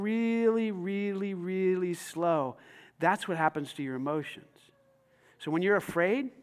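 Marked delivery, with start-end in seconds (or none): 0.0-2.6 soft, stretched words sinking downward; drawn-out slow. Acted. Preacher showing how emotions sink like a BB in oil, drawn-out slow. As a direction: draw each word out, getting quieter.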